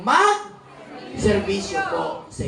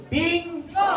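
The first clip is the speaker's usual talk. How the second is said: higher quiz-host call; shouted answer; 8 semitones higher than usual. Real, not acted. Senator quizzing the crowd, shouting the answer. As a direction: pop the answer out like a punchline.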